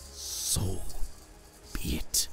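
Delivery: whispered, soft and beaten; king accepts his death, defeated whisper; bitter, defeated. A narrator's reading. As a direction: give up, breathing the words out. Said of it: "in a bitter, defeated voice".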